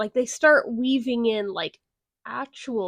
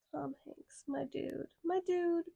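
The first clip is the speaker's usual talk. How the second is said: breathy sighing disbelief, much softer; exasperated sigh; 3 semitones higher, 12 dB quieter than usual. Real, not acted. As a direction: sigh his name in weary dismay.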